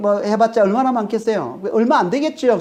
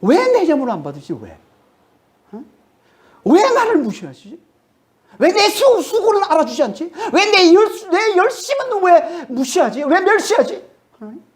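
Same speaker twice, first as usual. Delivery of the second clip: higher, aggrieved protest as Cain; Cain's indignant complaint; 9 semitones higher than usual. Pretend. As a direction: voice Cain's wounded anger at God. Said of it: "엄청 분노했다는 거예요". (억울해하며 하나님께 따지는 가인)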